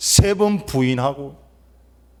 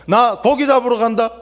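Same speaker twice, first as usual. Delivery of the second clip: higher-pitched declaration, acted as Peter; bold announcement; 8 semitones higher than usual. Peter telling the other disciples his plan, boldly announcing. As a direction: announce it to the whole group.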